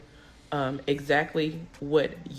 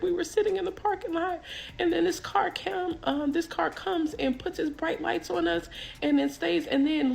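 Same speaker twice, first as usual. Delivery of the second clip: very high, imitated weepy voice; hammed-up tearful, pitched-up explanation; tearful account; 11 semitones higher than usual. Tearful passenger telling the police officer what happened, weepy. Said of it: "I got, I'm in tears".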